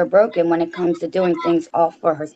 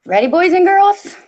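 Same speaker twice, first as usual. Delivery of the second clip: put-on high, bright call to the kids, much higher and louder; storyteller's hello; 12 semitones higher, 5 dB louder than usual. Storyteller gathering the boys and girls, high excited call. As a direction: sing it out like a children's show host. Opening story time for listeners.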